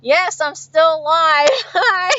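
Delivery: high, giggly live-chat hello; amused, breaking into laughter; laughing greeting. Real, not acted. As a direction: laugh your way through the greeting. Laughing while greeting returning live viewers.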